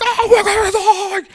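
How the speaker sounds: drawn-out, very high mock wail; slow hammed-up scream of doom; imitated panicked yelling. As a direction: mimic a man yelling in terror, laughing.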